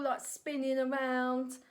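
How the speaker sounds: ordinary delivery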